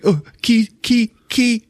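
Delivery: high squeaky voice; lightly hammed-up high pitch; mock high seer voice. Seer voiced in retold film scene.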